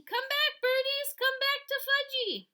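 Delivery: put-on high, loud, slow child's wail; shrill-free high toddler call, loud and drawn out; toddler screaming. A narrator's reading. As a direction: scream it like a little boy calling birds. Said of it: "was screaming".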